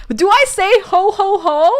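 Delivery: high, slow hearty ho ho ho; Pei Ming laugh. Pretend. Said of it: "If you have the Pei Ming laugh".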